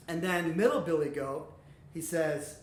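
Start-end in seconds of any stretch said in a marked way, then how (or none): none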